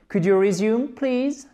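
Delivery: rising polite question; questioning example. Shown as a demonstration. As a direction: lift the pitch right up on "please". Models English example question.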